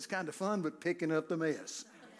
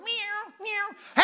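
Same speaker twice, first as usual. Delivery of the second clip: high, little mewing kitten; mock the church as a scared kitten; mock timid kitten; 5 dB louder than usual. Preacher mocking a timid church, high kitten mews. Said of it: "the church is going".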